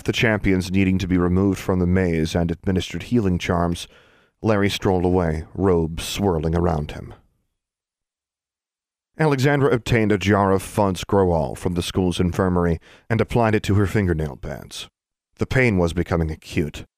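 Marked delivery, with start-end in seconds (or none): none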